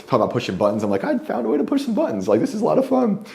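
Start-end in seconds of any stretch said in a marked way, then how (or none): 1.0-3.2 gleeful inner voice. Retold or imitated. Retold gleeful thought about reactions.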